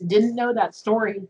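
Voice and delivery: ordinary talk, nothing marked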